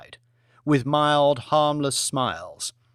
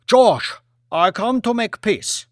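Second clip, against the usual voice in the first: higher, louder solemn offer; Premier's voice; 8 semitones higher, 7 dB louder than usual. Acted. Lee tells Lord Martin he comes in peace, higher and louder.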